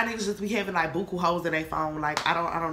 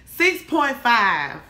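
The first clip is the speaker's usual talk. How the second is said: slow, louder, stretched; dragged-out rating; 4 semitones higher, 7 dB louder, much slower than usual. Real, not acted. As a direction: draw out the number teasingly.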